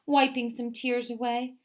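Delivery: ordinary delivery, nothing marked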